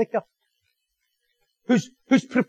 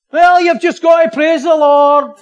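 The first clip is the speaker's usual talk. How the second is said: high, loud, slow put-on voice; 6 semitones higher, 9 dB louder, slower than usual. Preacher mimicking pat church advice, mock pious.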